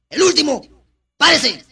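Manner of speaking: loud, high command; acted bullying order. Ex-convict ordering the last child to stand, loud acted command. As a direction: call the pupil up sharply.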